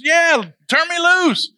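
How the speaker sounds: itching to be let loose; eager young fighter. Retold or imitated.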